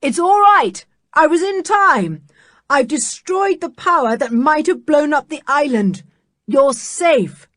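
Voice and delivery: high, loud, triumphant call; exultant shout. Acted. Uncle Quentin to everyone below, an exultant shout. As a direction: shout down the good news. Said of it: "shouted exultantly".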